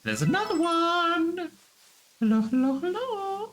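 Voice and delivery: very high falsetto cooing; high singsong calling. Pretend. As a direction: sing out a greeting to a creature. Calling sweetly to another creature.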